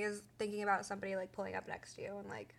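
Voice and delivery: fast, whispered fearful aside; hushed whisper. Real, not acted. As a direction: drop to a quick whisper. Describes a frightening abduction.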